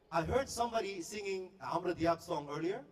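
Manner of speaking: ordinary talk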